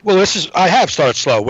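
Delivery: ordinary delivery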